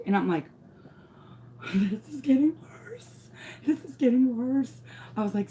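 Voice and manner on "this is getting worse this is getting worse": high whisper turning into squeaky voice; acted panic, from breathy whisper to high voice; rising panic. Mother watching her trouble grow, panicked.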